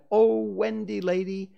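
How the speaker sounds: put-on high and loud begging boys; pleading cry. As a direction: beg her to be their mother.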